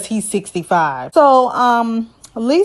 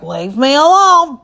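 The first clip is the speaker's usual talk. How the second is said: mock upset; 5 semitones higher, 5 dB louder than usual. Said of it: "he's like".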